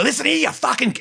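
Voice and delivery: high-pitched mock threat; imitated sudden harshness. Cute little wife flipping to the other side, imitated anger. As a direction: switch into her cursing side. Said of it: "and go the other side".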